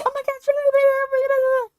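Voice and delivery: very high squealed babble; excited pitch climb. Shown as a demonstration. Showing family how excited talk sounds, high-pitched. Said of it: "my pitch goes up, and the sound goes up".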